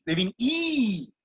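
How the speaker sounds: "I" held long and slow; teacher modelling a long vowel; long vowel demo. Shown as a demonstration. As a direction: stretch the "I" to show a long vowel. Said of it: "Il vient une longue voyelle".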